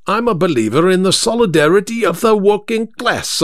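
high-pitched working-class London speechmaker; orating cabbie. Acted. Third driver lectures the gentleman on solidarity, orating. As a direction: declaim like a cab driver on a soapbox. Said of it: "something like a John Burns oration".

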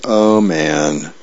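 low, dismayed dad groaning the words; low cartoon-dad lament. A narrator's reading. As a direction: drop the pitch in weary dismay. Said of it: "And Dad goes".

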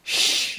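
soft, breath-only shush; hushing shh. A narrator's reading. Mother hushing her son as others sleep, softly. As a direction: hush him quietly. Ma hushes Tom, others asleep.

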